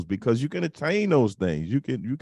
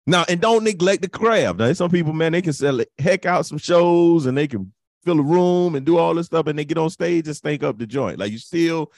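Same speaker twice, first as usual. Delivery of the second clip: rising impassioned oratory; 6 semitones higher, 3 dB louder than usual. Real, not acted. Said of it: "You sound like a Baptist preacher".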